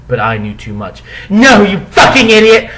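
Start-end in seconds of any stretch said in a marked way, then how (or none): 1.3-2.7 high, loud furious yell; angry shout. Acted. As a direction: scream the insult. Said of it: "I shouted".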